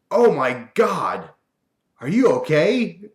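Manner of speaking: high, loud, stretched-out Oh my God; worried buddy crying out; alarmed friend. Retold or imitated. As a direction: friend gasping in shock at the accident. Mike panics at the flying wrench.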